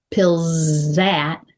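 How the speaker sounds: "pills that" as one word, long buzzing z; linked words. Shown as a demonstration. Coach showing where words link, linked words. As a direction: hold the z in "pills that" straight into the vowel. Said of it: "Especially when you get to".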